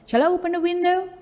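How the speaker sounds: "Shall I open the window?" sung up high as a model sentence; example question. Shown as a demonstration. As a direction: pitch it up like a polite offer. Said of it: "jawabannya yes atau no".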